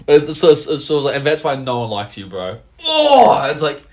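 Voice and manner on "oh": high, loud drawn-out "oh!"; exaggerated stunned reaction; mock shocked cry. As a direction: howl as if the insult landed. Acts out shock at insult.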